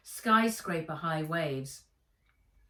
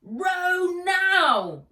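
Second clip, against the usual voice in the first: very high, loud roaring order; 12 semitones higher, 10 dB louder than usual. Acted. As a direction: roar the command over the storm. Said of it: "roared Ivy".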